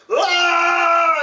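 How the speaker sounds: loud, high-pitched mock scream; imitated scream. Retelling the walrus reveal to a friend, loud imitated scream. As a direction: shriek it out in imitation. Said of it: "like that".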